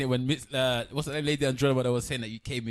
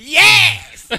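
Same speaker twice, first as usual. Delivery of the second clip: loud high laughing yes; excited shout; 16 dB louder than usual. Real, not acted. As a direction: shout it out triumphantly.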